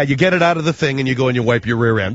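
normal talking voice

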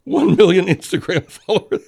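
high, loud laughing words; delighted laughing. Real, not acted. Laughing at the video.

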